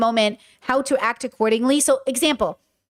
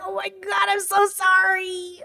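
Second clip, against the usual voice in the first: high, drawn-out apology; high-pitched re-enacted apology; gushing apology; 7 semitones higher, slower than usual. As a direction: squeak out a long, stretched sorry. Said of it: "I was like".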